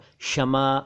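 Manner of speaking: क्षमा said as "shama", no k; wrong sh onset. Shown as a demonstration. Teacher quoting some people: क्षमा as "shama". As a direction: say क्षमा starting with bare sh. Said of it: "कुछ लोग".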